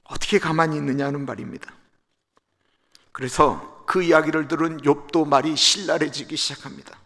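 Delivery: ordinary talk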